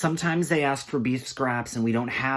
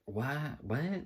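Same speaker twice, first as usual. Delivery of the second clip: soft, rising baffled question; mock bewildered customer; confused customer; 4 semitones higher, 10 dB quieter than usual. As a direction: ask it softly, pitched up in confusion. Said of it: "so confused".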